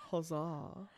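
"Huzzah." said low and soft, no lift, understated; flat classic huzzah. Shown as a demonstration. Friend imitates the real way it's said, quiet and deadpan. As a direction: drop "Huzzah." quietly and dryly. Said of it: "It's just, like, classic".

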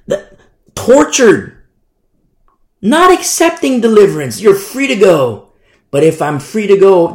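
loud, high preacher's cry; forceful preaching. Real, not acted. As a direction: hammer it out to the congregation. Preaching martyrs' torture at full force.

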